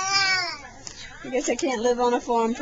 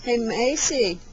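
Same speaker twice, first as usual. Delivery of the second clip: normal talking voice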